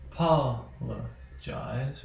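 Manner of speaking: "apologize" drawn out syllable by syllable, stressed on "pol". Shown as a demonstration. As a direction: say "apologize" very slowly, broken up, leaning on "pol". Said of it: "notice that is our last stress syllable".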